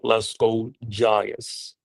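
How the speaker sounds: normal talking voice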